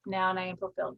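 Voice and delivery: slow, deliberate affirmation with long pauses; a calm, measured self-affirmation. Shown as a demonstration. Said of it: "So your command is".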